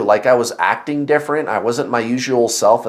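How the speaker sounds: normal talking voice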